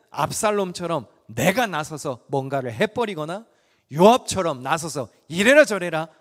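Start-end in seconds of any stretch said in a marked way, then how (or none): 5.3-6.1 이래라 저래라 said louder, bossy; loud mocking command; bossy mock order. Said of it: "지시하는 교만함".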